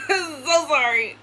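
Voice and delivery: laughing through a slow, high so sorry; real giggle while saying sorry; laughing apology. Real, not acted. Laughing to viewers about the catfish playing in the background.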